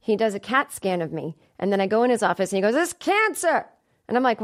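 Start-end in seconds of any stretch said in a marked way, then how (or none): 2.8-3.6 high-pitched mock pronouncement; imitated older doctor. Older doctor giving scan result, high mock alarm.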